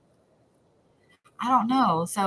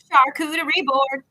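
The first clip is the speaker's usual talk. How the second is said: excited; 7 semitones higher, 4 dB louder than usual. Real, not acted. Showing off her snack.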